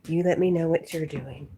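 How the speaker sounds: loud call to the dog. Real, not acted. Owner calling loudly to her dog Jack. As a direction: call it out to the dog across the room.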